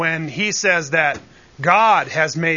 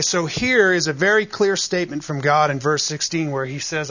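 usual speaking voice

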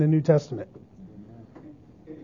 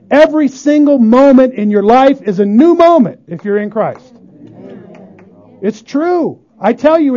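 louder, higher, driving sermon voice; preaching at full force; 6 semitones higher, 5 dB louder than usual. Acted. Preacher drives home forgiveness to the congregation, full force. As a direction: press the gospel home to the room.

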